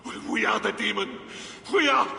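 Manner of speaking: high, fast ecstatic cry; rising fervent proclamation; ecstatic shout. Acted.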